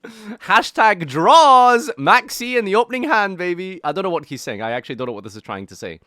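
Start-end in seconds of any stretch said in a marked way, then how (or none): 0.0-3.8 high, loud, stretched hype voice; ironic zoomer. Retold or imitated. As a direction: hype it like a social media ad. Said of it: "Social media marketing for Maxi, dude".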